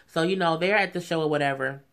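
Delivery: usual speaking voice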